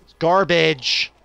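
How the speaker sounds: slow, loud and disgusted; angry yell. Real, not acted. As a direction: drag it out in disgust. Yelling at the map.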